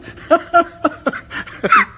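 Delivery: a squeaky laugh shooting up high; high nervous titter. Acted. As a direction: let the laugh crack up into a squeak. Laugh dies into realisation.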